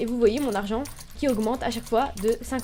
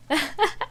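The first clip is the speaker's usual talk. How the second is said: short, higher-pitched laugh; amused laugh; 4 semitones higher than usual. Real, not acted. Player cannot find the new fish, amused laugh. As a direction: laugh at your own failed experiment.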